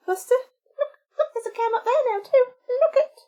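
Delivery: high, coaxing pet voice. Real, not acted. Owner to her cat Buster, high coaxing baby talk.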